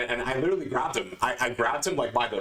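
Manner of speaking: ordinary talk, nothing marked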